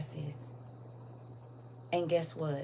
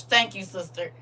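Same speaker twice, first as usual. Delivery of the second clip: ordinary talk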